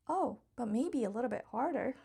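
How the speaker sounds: usual speaking voice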